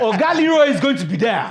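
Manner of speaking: put-on very high, loud Nigerian accent; triumphant hammed-up Nigerian boast. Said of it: "bring that Nigerian accent".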